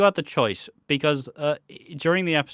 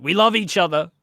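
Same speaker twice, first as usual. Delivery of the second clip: lovestruck declaration; 5 semitones higher, 4 dB louder than usual. Retold or imitated. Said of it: "Ravi's like".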